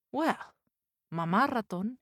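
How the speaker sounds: plain reading voice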